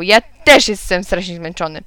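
usual speaking voice

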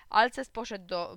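plain reading voice

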